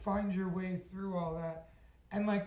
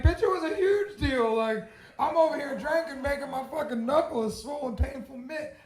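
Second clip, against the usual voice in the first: much higher, louder indignant yell; outraged retort; 7 semitones higher, 6 dB louder than usual. Retold or imitated. Retelling his comeback to a dismissive friend, loud and outraged. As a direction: blast it back at her, offended.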